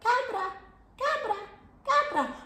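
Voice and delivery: cabra said high and bright, KA-bra, falling, ending in a laugh; Spanish word model. Shown as a demonstration. Host models cabra for the kids, high playful repeats. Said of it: "Let me hear you saying cabra".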